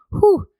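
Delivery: high, loud "whew"; quick high-pitched whooshing noise; imitated whoosh. Shown as a demonstration. Speaker shows feelings being stuffed back down, high whoosh.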